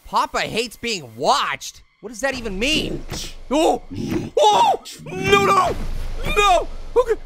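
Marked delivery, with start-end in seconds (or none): 3.5-7.2 terrified screams. Real, not acted. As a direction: scream in real fright at the jump scare. Jump scare in horror game.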